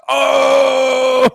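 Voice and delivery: high, loud drawn-out "oh"; mock outcry. Co-host showing how you're supposed to react across the table, loud mock outcry. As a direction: wail it out in mock outrage. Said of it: "supposed to be on this side of the table going".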